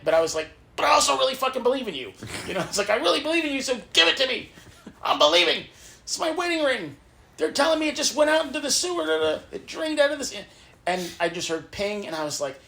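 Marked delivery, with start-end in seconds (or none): 0.8-10.3 high, loud, rushing plea to God; desperate pleading. Retold or imitated. Retelling begging God for her wedding ring, in a high excited plea. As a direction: beg God like your faith depends on it.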